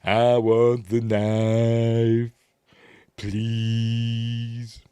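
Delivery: extremely drawn-out, monotone plea; mock film impression. Mimicking his request in the film, very slow drone. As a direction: hold every vowel far too long. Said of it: "And he goes".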